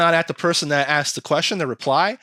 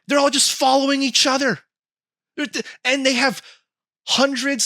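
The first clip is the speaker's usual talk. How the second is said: high, emphatic rant; real incredulous ranting, pitched up; worked-up exasperation; 11 semitones higher, 3 dB louder than usual. Real, not acted. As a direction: hammer home the absurd discovery. Ranting about bots following each other.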